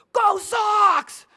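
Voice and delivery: high, loud stadium cheer; fan yelling. Retold or imitated. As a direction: bellow it from the back row like a Boston fan. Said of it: "this guy way up in the back jumps up and goes".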